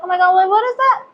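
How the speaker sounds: high, fast startled burst; quick surprised gasp of words. Real, not acted. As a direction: blurt it out in sudden alarm. Spots something on her face.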